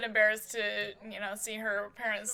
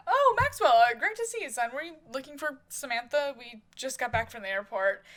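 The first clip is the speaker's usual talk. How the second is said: loud, high "oh" easing down to usual; hearty greeting; 3 semitones higher, 6 dB louder than usual. Acted. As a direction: voice jumps high and loud, then settles. Father greets visitor at the door.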